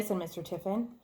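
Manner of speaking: ordinary delivery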